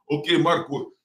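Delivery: high, loud imitation of an old-country father's accent; dad's old-country accent. Son imitates his immigrant dad's advice in the dad's old-country accent. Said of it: "in his accent".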